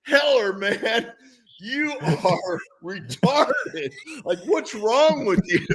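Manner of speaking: slow teasing, breaking into laughs; fake-scold while cracking up; mocking through laughter. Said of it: "I was like".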